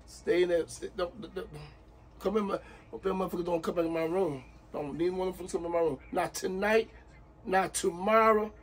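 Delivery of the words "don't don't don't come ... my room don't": ordinary talk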